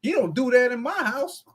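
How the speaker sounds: complaining mother. Retold or imitated. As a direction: protest like a fed-up mother. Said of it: "And then Miss Tiffany, like".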